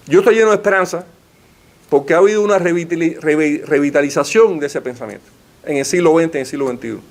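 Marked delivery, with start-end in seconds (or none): none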